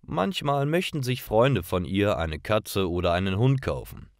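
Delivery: ordinary delivery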